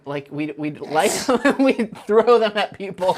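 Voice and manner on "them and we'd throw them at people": ordinary talk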